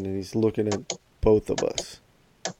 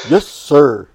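loud, high mock obedience; over-eager sarcastic salute of a reply; sarcastic kid; 5 semitones higher, 11 dB louder than usual. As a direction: imitate a cheeky child snapping to attention. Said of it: "I'm pretty sure he's being sarcastic".

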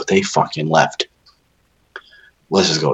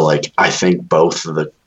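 ordinary talk

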